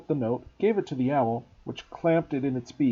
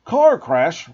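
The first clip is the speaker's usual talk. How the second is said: loud roar on Car crash; 4 semitones higher, 11 dB louder than usual. Acted. Hagrid roaring at the Dursleys, furious roar. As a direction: bellow it in outrage. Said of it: "roared Hagrid".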